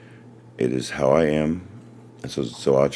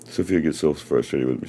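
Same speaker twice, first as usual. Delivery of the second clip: usual speaking voice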